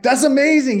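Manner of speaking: high, loud re-enacted congratulations; delighted reaction. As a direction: cheer for your buddy's success. Delighted reply to the good news.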